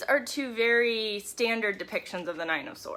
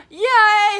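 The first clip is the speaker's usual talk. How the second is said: excited squeal; 11 semitones higher, 14 dB louder than usual. Real, not acted. Excited reaction to a viewer.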